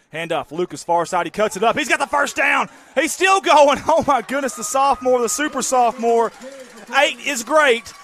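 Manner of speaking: rising, high, loud call of the run; excited play-by-play. Real, not acted. Announcer calling a first-down run, building to a shout.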